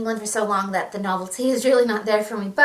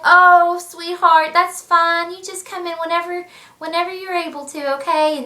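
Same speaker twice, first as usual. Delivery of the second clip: higher, sugary nurse cooing at him; doting nurses; 7 semitones higher, 5 dB louder than usual. Retold or imitated. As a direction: coo it like a charmed nurse. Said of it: "they're like".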